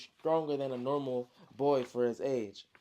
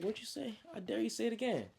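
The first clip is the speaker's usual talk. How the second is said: angry dare; 7 semitones higher, 4 dB quieter than usual. Retold or imitated. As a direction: dare him to say it, voice climbing. Angry brother daring a challenge.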